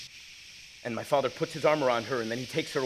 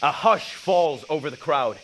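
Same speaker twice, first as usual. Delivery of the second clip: louder, higher, building thrill; rising excitement; 4 semitones higher, 7 dB louder, slower than usual. Acted. Stepping onto the biggest stage of his life, thrilled. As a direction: ride the roar of the crowd.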